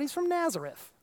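mocking scoff.

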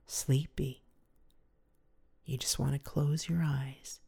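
ordinary delivery

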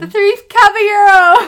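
very loud, high alien voice. Pretend. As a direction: belt it out like an alien. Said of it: "you can do the alien".

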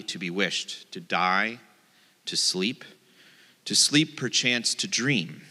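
hushed theatrical monologue; dramatic recitation. Acted. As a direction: deliver the soliloquy like a brooding actor. Dramatic reading of Hamlet.

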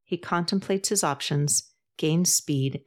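usual speaking voice

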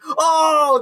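very high, thrilled "Oh"; excited gasp. Retold or imitated. Cast hearing the Watch Mojo voice, delighted high gasp. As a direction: squeal with delight. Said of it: "they're so excited".

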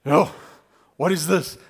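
loud, retold astonishment; acted-out startled outburst; startled onlookers. Onlookers startled by woman collapsing.

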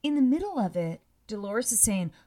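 normal talking voice

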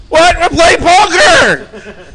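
put-on high, loud, dragged-out drunken whine; a sloppy drunk, slow and high; drunk protest. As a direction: play the slurring drunk who won't leave.

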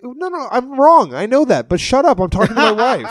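high mocking dad impression. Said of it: "he's like".

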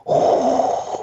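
voiceless rushing whoosh; imitated dragon fire. Dragons strafing a whole legion of whites, imitated fire whoosh. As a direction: make a noise of fire pouring out.

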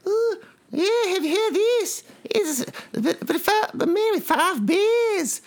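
host chuckling at his own headline, hammed-up South African accent; South African Jay Leno. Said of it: "Jay Leno with a South African accent".

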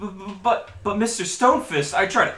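high, loud, panicked stammer. Acted. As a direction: plead in terror, stumbling. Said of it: "He's like".

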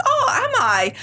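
high-pitched, questioning "oh"; mock surprise. Model told she's in the paper, mock surprise. Retold reaction to the news.

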